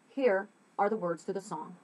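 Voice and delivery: usual speaking voice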